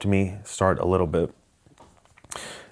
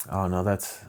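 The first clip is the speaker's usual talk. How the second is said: ordinary delivery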